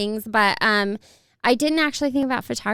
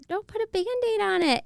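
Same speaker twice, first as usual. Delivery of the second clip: high mock plea; 10 semitones higher than usual. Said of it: "And it's like".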